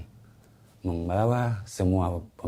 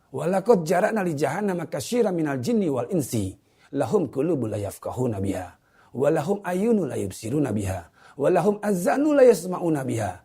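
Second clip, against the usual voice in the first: raised, ringing verse recitation; Quran recitation; 6 semitones higher than usual. Acted. Preacher reciting scripture to his viewers, raised recitation. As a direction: recite the verse with rising force.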